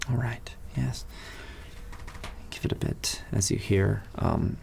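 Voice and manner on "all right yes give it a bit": whispered. Acted. Hushed agreement in tavern.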